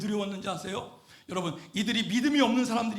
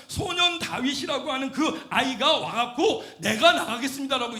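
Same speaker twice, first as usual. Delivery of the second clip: urgent, raised pulpit voice; impassioned preaching; 5 semitones higher, 7 dB louder than usual. Real, not acted. Preacher tells of young David stepping forward, impassioned preaching. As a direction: rouse the congregation with a lifted, insistent voice.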